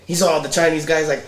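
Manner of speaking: ordinary talk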